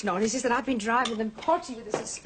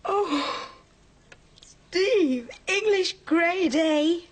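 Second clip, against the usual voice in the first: much higher and louder, drawn out with delight; thrilled; 8 semitones higher, 4 dB louder, much slower than usual. Acted. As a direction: savour each grade like a proud mum. Mother reads son's exam results.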